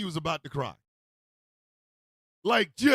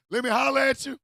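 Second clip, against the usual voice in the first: pushy, raised call; too aggressive; 5 semitones higher, 4 dB louder than usual. Acted. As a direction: press them hard with a higher voice. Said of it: "you get too aggressive".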